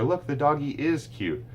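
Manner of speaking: put-on gentle recast for a toddler; parentese model. Shown as a demonstration. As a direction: lift it brightly for the child.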